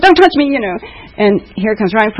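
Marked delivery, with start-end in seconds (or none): 0.0-0.4 put-on very high, bright singsong for a little boy; mother hyping a siren for her toddler son; excited mom to a toddler. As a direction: point out the siren to a toddler, pitched way up.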